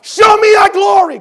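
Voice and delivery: loud, slow, high-pitched plea; desperate loud prayer cry; crying out to God. Acted. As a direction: shout it to heaven, stretched out. Sermon opening cry to God.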